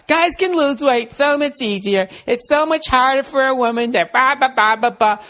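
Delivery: high mocking girly voice; mocking women. Mocking his girlfriend's complaint, high girly voice. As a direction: trail off into mocking ba ba ba. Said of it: "they always try to make it seem".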